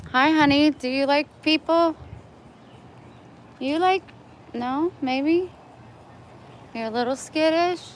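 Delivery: high, sing-song coaxing. Real, not acted. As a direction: coax a shy cat gently. Talking to a shy cat.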